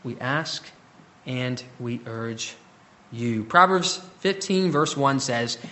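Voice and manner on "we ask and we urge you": soft and slow, quieter; tender asking. Retold or imitated. Paul gently urging the church, soft and slow. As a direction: urge them gently, no hammer. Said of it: "doesn't come off with a hammer".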